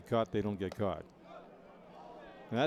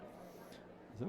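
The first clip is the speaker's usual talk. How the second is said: ordinary talk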